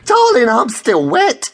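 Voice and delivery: high, slow, drawn-out gush; camp exclamation. A narrator's reading. Angie raving to friends about a man, high and gushing. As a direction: swoop up high and draw it out.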